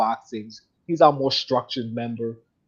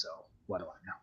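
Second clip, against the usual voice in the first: ordinary talk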